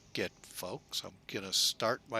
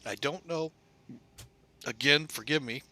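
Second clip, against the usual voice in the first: talking through hiccups; hiccuping between words; 3 semitones higher than usual. Real, not acted. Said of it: "I got hiccups".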